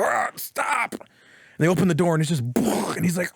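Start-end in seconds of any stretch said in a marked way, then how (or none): 0.0-0.9 higher, half-hearted cry of pain; mock scream. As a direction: imitate a muffled plea from a beating. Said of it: "you hear screaming".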